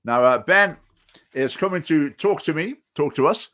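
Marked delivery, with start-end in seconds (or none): none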